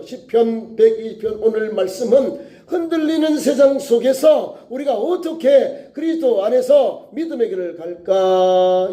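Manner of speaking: usual speaking voice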